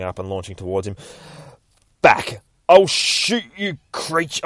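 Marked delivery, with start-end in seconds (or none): 2.0-2.4 loud sharp command; barked warning. Acted. Doctor threatens the creature. 2.7-4.4 high, slow, loud threat; panicked threat. Acted. Doctor threatens the creature.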